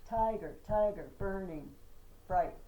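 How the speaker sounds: Tiger, tiger, burning bright, strong first syllables, slow and loud; slow chant with loud first beats; trochaic stress. Shown as a demonstration. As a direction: pound out each trochee. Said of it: "an extra da on the end".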